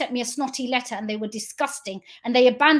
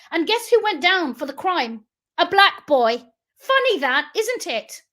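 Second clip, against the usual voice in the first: raised, indignant accusation; bitterly angry, pressing each phrase; real anger; 4 semitones higher, 4 dB louder than usual. Real, not acted. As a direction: let the anger push your voice higher and harder. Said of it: "I'm so angry".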